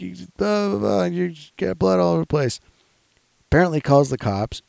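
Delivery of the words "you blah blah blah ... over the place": high-pitched mock complaint; Uber driver. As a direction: imitate a fed-up driver, pitched way up. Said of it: "And he's like".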